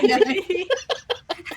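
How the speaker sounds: loud, high burst of laughter; laughing out loud. Real, not acted. Player breaking character when teased about the voice, loud high laugh. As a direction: crack up at the comment.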